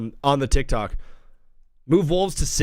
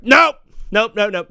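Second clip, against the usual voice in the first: mock censor; 5 semitones higher, 6 dB louder than usual. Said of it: "they're like".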